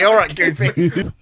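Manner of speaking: high goofy cartoon voice; silly cartoon dog voice. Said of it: "I was about to say".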